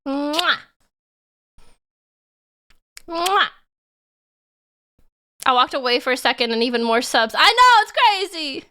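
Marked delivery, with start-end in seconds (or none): 0.1-3.5 blowing a kiss to a viewer named Fluffy; kiss sound. Real, not acted. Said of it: "Here's a kiss for you". 7.4-8.7 louder and higher disbelief; more subs came in, louder excited disbelief. Real, not acted.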